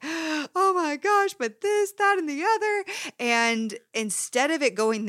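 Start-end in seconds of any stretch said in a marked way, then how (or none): 0.0-2.8 much higher, slow, panicky rush of worries; panicked spiral. Retold or imitated. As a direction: act out a mind spiralling into panic. Acting out an anxious thought spiral.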